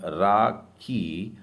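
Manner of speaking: राखी stretched out slowly; very slow model syllables. Shown as a demonstration. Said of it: "मतलब". (राखी बहुत धीरे)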